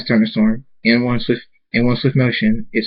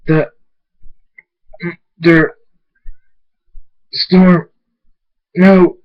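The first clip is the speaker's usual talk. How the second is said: shocked, broken words; 7 semitones higher, 5 dB louder, much slower than usual. A narrator's reading.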